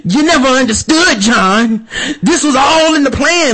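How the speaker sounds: high-pitched melodramatic mid-fight reveal; mock movie villain. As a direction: parody an overwrought action-film confession. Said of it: "punches him and goes".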